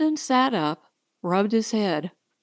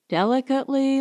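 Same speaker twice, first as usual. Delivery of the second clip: usual speaking voice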